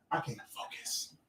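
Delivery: unvoiced whisper. Struggling to pray, whispering. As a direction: breathe it out, strained.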